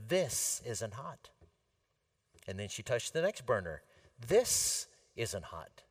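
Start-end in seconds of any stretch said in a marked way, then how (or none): 0.1-1.3 put-on slow, smug little-girl claim; lightly voiced arrogant child; confident arrogant. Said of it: "said very confidently and arrogantly". 4.2-5.8 put-on slow, smug little-girl claim; lightly voiced arrogant child; confident arrogant. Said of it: "said very confidently and arrogantly".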